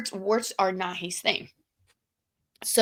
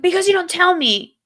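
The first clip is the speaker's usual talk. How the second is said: higher, louder accusation; mock indignant retort; 10 semitones higher, 9 dB louder than usual. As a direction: complain at him, raised voice. Arguing with boyfriend.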